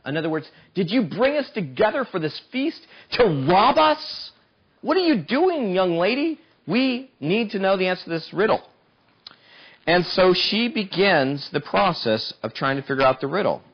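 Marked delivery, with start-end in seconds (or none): 0.7-8.7 lightly voiced accusing mob; a hint of demanding bullies; mock interrogation; pressing Philistines.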